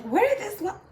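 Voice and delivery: startled high-pitched question, cut off mid-word; surprised. Retold or imitated. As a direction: sound suddenly caught off guard by a stray seed. Quoting herself finding a seed.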